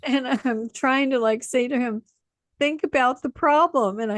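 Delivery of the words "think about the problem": laughing through words. Retold or imitated. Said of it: "contagious laughs".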